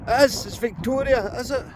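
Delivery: excited high voice. Real, not acted. Identifies the silver coin.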